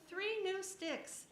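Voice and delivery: lightly voiced quirky stick collector; Stickler voice. A narrator's reading. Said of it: "said Stickler".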